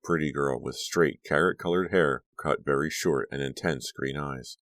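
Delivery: plain reading voice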